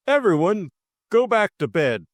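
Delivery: high, loud call to a group; officious announcement. A narrator's reading. George sending the household to bed, officious. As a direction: raise the voice to take charge.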